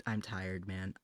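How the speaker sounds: ordinary talk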